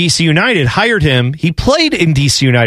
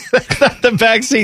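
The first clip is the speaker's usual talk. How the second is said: laughing; 7 semitones higher than usual. Real, not acted. Laughing at the boot joke.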